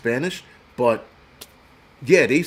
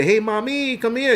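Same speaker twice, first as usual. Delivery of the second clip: higher, cocky catcall voice; street catcall; 9 semitones higher than usual. Retold or imitated. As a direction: imitate a guy hollering at a girl on the corner. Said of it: "Dudes say".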